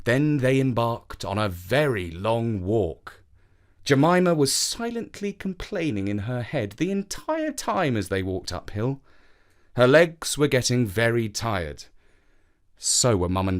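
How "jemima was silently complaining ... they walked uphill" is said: plain reading voice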